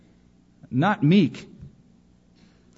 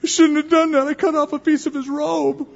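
very high, louder guilty wail; hammed-up pangs of conscience, pitched way up; mock guilt-ridden; 14 semitones higher, 4 dB louder than usual. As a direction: lament it in an exaggerated high voice. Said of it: "he starts feeling guilty".

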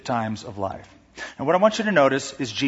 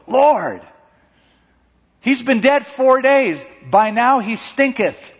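high, slow, disgusted protest; object, drawn out and high; protesting Martha; 9 semitones higher, 5 dB louder, slower than usual. Retold or imitated. Voicing Martha protesting the stone.

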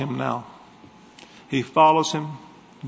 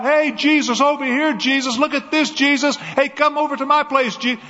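crowd calling out; 10 semitones higher than usual. Acted.

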